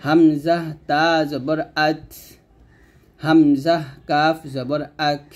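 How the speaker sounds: slow chanted spelling, puff of air ending "আত" and "আক"; hams, breath released. Shown as a demonstration. Teacher shows learners the way, "আত" and "আক" slow, ending in breath. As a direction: let air out on the last consonant of "আত" and "আক". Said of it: "এরকমভাবে উচ্চারণ করতে হবে".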